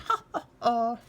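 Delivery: light storybook laugh; a cheerful goblin laugh. A narrator's reading. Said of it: "he laughed".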